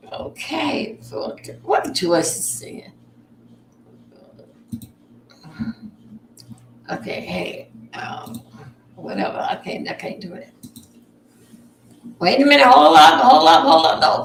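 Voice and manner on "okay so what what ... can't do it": hushed whisper, barely voiced. Real, not acted. Whispering to himself while searching TikTok. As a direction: whisper it so nobody hears.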